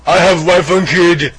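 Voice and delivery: mock Austrian tough guy; Arnold-style accent. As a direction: bark it like an action star. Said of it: "grunt impression".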